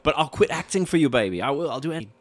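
hammed-up lovesick promise; mock pleading. Acts boyfriend begging to keep her.

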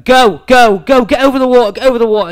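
loud, high urging at the ball; imitating an overeager playing partner; partner yelling. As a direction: shout the ball over the water.

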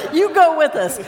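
urging call. Pretend. Preacher urges faith volunteer.

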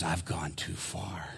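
hushed whisper, slow; whispered confession. Retold or imitated. Prisoner confessing to the preacher, whispered. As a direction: breathe it out like a heavy secret.

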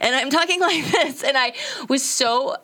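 high, bleating voice of someone holding back tears; goat woman voice. Shown as a demonstration. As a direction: bleat it out like a goat while fighting not to cry. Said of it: "I'm goat woman because I'm trying not to cry".

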